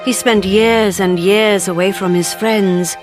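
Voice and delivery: usual speaking voice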